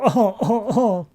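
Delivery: high-pitched mock evil laugh; villainous chuckle. Villains plotting, narrator voices their laugh.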